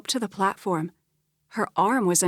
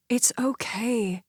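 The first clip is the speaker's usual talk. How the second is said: slow soothing whisper; gently calm her down; 4 semitones higher, slower than usual. Acted. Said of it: "Ava soothed".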